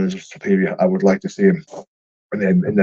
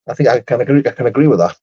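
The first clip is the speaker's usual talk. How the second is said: usual speaking voice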